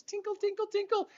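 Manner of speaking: high, soft bell-like tinkle tinkle; tinkling bell imitation. Storyteller imitating the shop door bell, high and soft. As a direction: chime it like a little shop bell.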